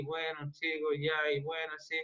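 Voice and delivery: sloppy bored talk. Shown as a demonstration. Teacher showing students how not to address a class, mumbled careless. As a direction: toss it off like you don't want to be there. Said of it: "y le hablara así".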